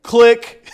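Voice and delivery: sharp high click noise shouted; loud mock phone click. Reacting to a bad pitch, a loud mock hang-up click.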